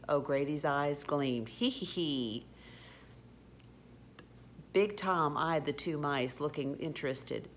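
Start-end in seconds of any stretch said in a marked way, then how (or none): 1.5-2.5 wheezy "hee, hee, hee"; mischievous villain giggle; sly snicker. A narrator's reading. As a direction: gloat with a little laugh. O'Grady gloats over stowaways.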